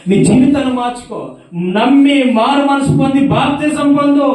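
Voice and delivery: high, loud sung-out exhortation; full-force preaching. Acted. Preacher exhorting the woman to repent, loud chanting preaching.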